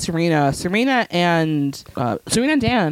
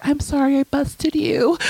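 high, small apologetic voice; mock-sheepish, pitched-up apology; guilty; 10 semitones higher than usual. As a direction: imitate a guilty little girl. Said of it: "Elise looks guilty".

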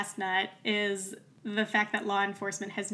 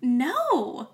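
shocked protest; 12 semitones higher, 3 dB louder than usual. Retold or imitated. Amanda voiced protesting innocence.

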